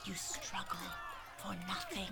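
quiet gloating menace; menacing hush. Acted. Elder threatening captive girl.

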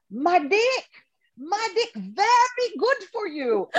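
put-on Ketut's thick Balinese accent, pitched high and slow; imitating Ketut. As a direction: savour each word like a proud old priest. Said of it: "And he says".

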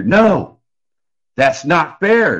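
high, loud cry of protest, dragged out slowly on the second part. Retold or imitated. Man being escorted out shouting his protest at the judge. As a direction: shout that it's unfair across the courtroom. Courtroom outburst as he is led out.

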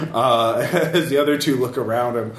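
ordinary delivery

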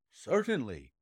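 plain reading voice

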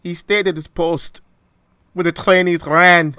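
put-on emotional quote in an amateur Scottish accent; Scotty. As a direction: ham up a Scottish accent with rolled r's. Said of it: "Don't make fun of my accent".

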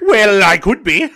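slow, loud, high Irish brogue; mock Irish accent. Answering that he's not in a major motion picture, mock Irish accent.

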